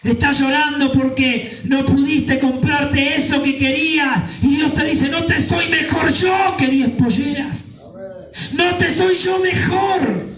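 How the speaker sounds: building to a high, ringing exclamation; impassioned preaching. Acted. Preacher voices God asking the weeping believer, impassioned and rising. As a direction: pitch climbing steadily into God's insistent plea.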